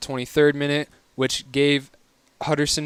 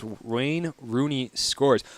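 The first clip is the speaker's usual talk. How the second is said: very slow, a bit softer, tasting each word; drawn out as if testing how odd it sounds; savoring the odd phrase; 4 dB quieter, much slower than usual. Shown as a demonstration. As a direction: stretch it out, amused disbelief. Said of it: "that's a weird thing to say".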